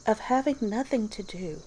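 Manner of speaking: plain reading voice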